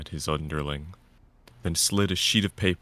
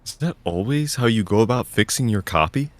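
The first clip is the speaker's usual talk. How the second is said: stern question; 6 semitones higher, 8 dB louder than usual. Acted. Senior writer scolding.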